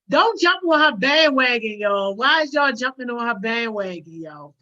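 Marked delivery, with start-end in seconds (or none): none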